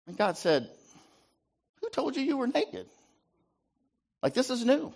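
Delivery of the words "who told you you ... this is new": much higher, incredulous question; surprised God. Acted. God questioning Adam about his nakedness, much higher and incredulous. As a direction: sound genuinely taken aback.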